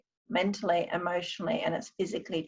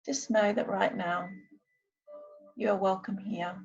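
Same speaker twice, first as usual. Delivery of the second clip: guided meditation; 6 semitones higher, 4 dB quieter than usual. Acted. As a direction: ease them gently into calm. I'm going to now do a short meditation.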